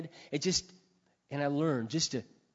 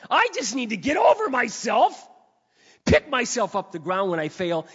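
high, loud, impassioned pulpit confession; fervent preacher on a roll; full-force preaching; 8 semitones higher, 10 dB louder than usual. Real, not acted.

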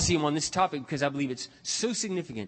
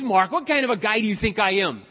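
retold indignation; 7 semitones higher, 6 dB louder than usual. Friend takes offence at robbery question.

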